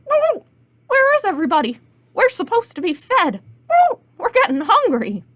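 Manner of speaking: high, loud squawking hens; hungry chickens with shrieked whoops. Acted. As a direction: cluck and squawk the complaint. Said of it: "all the chickens said".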